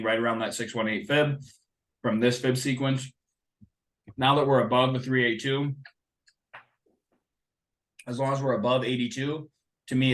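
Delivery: usual speaking voice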